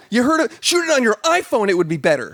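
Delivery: high-pitched acted indignation; exasperated retort. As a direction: scold an imagined production team, pitch way up. Acting out his exasperated reaction.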